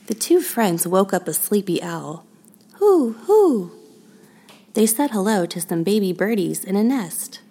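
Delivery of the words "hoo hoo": high, loud hooting owl call; owl hoots. Acted. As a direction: hoot like a sleepy owl, up high. Owl waking up in tree.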